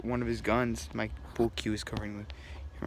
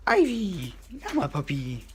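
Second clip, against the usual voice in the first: sharp gasp, then calls the dog's name high and loud; startled gasp; 7 semitones higher, 5 dB louder than usual. Real, not acted. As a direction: caught off guard as the puppy bursts in. Dog barges into shot.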